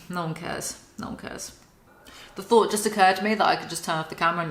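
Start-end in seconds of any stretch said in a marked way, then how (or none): 0.1-0.8 low, soft aside; dismissive mumble. Real, not acted. Trailing off, repeats dismissal. 1.0-1.5 barely audible, breath-only whisper; dismissive whisper. Real, not acted. Repeats dismissal under the breath.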